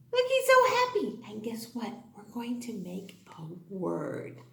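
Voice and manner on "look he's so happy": excited. Acted. Excited about the puppet.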